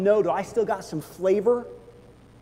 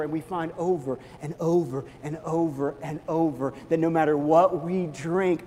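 ordinary delivery

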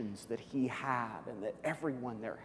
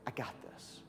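whispered reassurance, much softer; hushed, confident whisper; 10 dB quieter than usual. Acted. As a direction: breathe it like a quiet promise. Jesus reassuring the sisters.

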